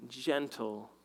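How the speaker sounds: normal talking voice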